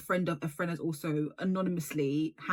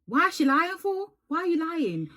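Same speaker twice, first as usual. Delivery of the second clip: mocking; 10 semitones higher, 6 dB louder than usual. Mocking the liar, high pitched.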